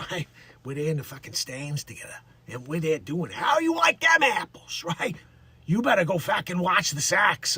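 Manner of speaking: a light Bronx wiseguy voice, only slightly put on; a touch of a Bronx guy at a ball game; Dick Facce character. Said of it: "I went into a little Dick Facce".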